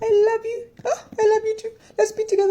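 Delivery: very high, weepy melodramatic declarations; mock sobbing lovers. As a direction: ham up a tearful last-minute reunion. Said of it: "They're gonna be like".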